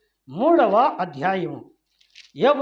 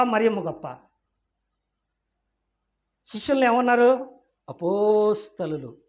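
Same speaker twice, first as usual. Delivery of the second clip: usual speaking voice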